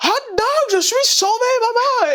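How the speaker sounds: beam it at mom; jubilant cry. Retold or imitated. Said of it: "Il dit".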